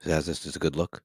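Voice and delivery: podcast voice. Shown as a demonstration. Co-host testing a podcast sound for Steve, low voice. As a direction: drop low, right on the microphone. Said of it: "How's this, Steve? Would this be a good podcast?".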